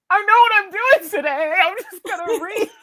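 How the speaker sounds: high, loud, gleeful announcement; like a kid who just got the perfect plan; excited glee. Retold or imitated. Retelling her excited realization.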